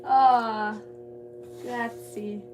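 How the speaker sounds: ordinary talk, nothing marked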